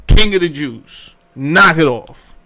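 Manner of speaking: usual speaking voice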